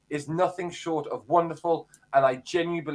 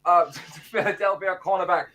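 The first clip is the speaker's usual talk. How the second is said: a touch higher, announcer-style history read; segment presenter voice; 4 semitones higher than usual. Acted. Phil does his weekly history feature for the podcast, announcer-style. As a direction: present it like a radio feature, lightly. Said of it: "time for your voice, mate".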